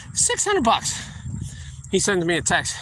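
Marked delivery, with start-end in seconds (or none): 0.1-1.0 high, stunned repeat of the price; amazed emphasis. Real, not acted. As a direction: let the amount sink in with disbelief. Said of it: "I charged this guy six hundred bucks".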